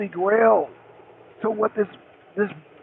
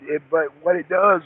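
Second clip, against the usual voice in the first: normal talking voice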